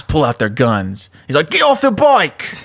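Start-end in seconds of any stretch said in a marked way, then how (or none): 1.5-2.6 high, drawn-out British cop's order; British accent. Acted. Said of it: "gotta do my British accent".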